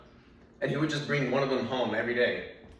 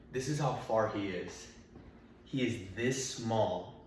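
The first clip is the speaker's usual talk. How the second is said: usual speaking voice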